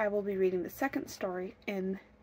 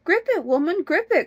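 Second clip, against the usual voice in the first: high, louder urgent call; 8 semitones higher, 10 dB louder than usual. A narrator's reading. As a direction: urge the wife to grab it. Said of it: "called her husband".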